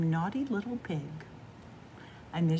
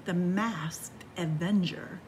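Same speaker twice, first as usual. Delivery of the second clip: ordinary delivery, nothing marked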